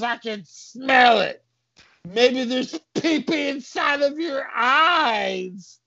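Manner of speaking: high, tearful cartoon cop; high-pitched crybaby policeman; wailing cop. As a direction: blubber in protest, drawn out. Cop wails back at insult.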